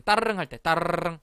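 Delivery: "따르릉" with a long trilled r like a bell; rolled R. Shown as a demonstration. Teacher comparing R to a bicycle bell, rolled R. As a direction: roll the "르" in "따르릉". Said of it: "이런 식으로".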